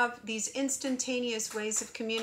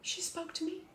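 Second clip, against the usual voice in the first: imitated awed listener; 7 semitones higher than usual. Voicing a listener who heard the French, hushed amazement. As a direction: play a listener thrilled to be singled out. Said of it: "heard n'est-ce pas and went".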